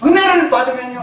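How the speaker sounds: ordinary talk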